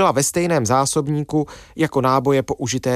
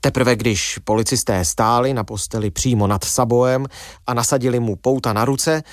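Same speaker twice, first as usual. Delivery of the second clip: usual speaking voice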